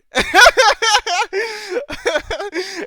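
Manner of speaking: helpless high cracking laugh; high laughing fit. Real, not acted. Mocking the show's plot to viewers, bursting into a high helpless laugh. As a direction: laugh until the voice breaks up.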